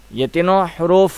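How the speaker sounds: ordinary talk, nothing marked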